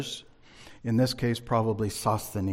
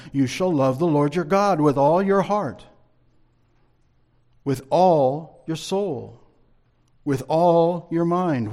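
solemn scripture; 5 semitones higher, 5 dB louder than usual. Acted. Quotes Jesus's command.

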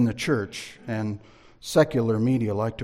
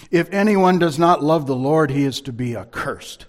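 stern warning; 4 semitones higher, 7 dB louder than usual. Acted. Reading a stern curse.

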